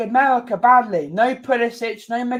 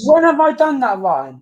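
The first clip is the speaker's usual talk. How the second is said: high, loud challenge; indignant protest; 5 semitones higher, 4 dB louder than usual. Real, not acted. Co-host challenges Ryan's accusation, indignant protest. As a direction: demand proof, voice raised in real annoyance. Repeats protest over co-host's objection.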